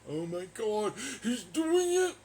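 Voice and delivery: shrieking high, loud mock glee; mock excitement. Streamer mocking a caller, in shrill mock glee. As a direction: squeal it like a delighted fan.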